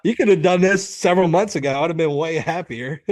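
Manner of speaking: hammed-up disbelief at his wife's sudden change; mock bewilderment. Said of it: "I'm like".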